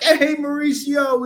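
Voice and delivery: very high, slow, sing-song hello; calling out to Mauricio, high and sing-song; cheery greeting. Retold or imitated. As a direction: greet him bright and drawn out. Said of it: "I'm like".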